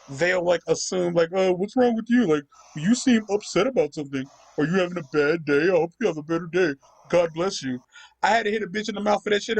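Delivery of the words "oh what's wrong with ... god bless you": mock concern. Mimicking a smug commenter to a friend, mock concern. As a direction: parody a condescending commenter's pity. Mocking internet fake concern.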